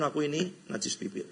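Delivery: humble confession. Retold or imitated. Preacher voices Isaiah before God's glory, soft and humble. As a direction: confess it softly, ashamed.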